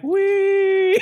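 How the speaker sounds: high squealed wee; giddy piggyback wee; mock glee. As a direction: squeal like a kid on a ride. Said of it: "I'm like".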